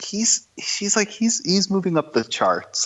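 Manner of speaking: wife explains her wrestler crush to her husband; imitating wife. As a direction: act the wife, a touch prim.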